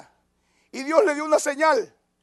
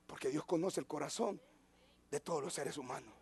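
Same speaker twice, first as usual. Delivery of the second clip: hushed preaching; 7 semitones lower, 12 dB quieter than usual. Acted. Sermon drops to intimate reflection.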